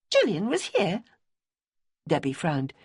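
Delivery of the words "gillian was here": higher, startled echo of Gillian; surprised question. A narrator's reading. Debbie startled that Gillian visited Simmy, surprised. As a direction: catch on the name Gillian in surprise.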